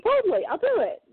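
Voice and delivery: eager servant. Pretend. Eager offer to clean crypt.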